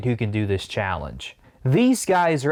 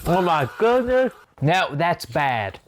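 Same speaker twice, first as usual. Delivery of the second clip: sour candy in mouth, slowed; talking through a mouth-puckering sour; eating sour candy; 4 semitones higher than usual. Real, not acted. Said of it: "I had that one in my mouth".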